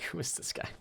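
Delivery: whispered, puzzled question; hushed imitation of the donor; mock whisper. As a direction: breathe it like a secret. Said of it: "he's like".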